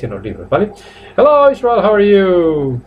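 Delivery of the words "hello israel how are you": exaggerated greeting. Real, not acted. Teacher greets student Israel mid-lesson, high-pitched exaggerated hello. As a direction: hail the student high and stretched out.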